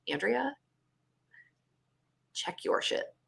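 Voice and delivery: whispered, stern note to self; self-scolding whisper. Retold or imitated. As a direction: hiss a warning at yourself. Scolding herself in thought.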